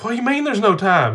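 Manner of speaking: high, loud, baffled holler; retold incredulous shout. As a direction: re-enact yelling back in disbelief. Dad yelling back at child.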